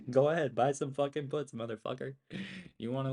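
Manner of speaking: a mocking dare with a laugh in it; laughing taunt. Real, not acted. To the doubter in chat, a loud laughing dare.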